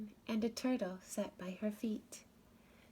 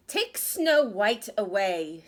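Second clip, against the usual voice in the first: loud, slow, angry order; angry queen command; 9 dB louder, slower than usual. Acted. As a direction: order it with cold fury. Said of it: "she told him".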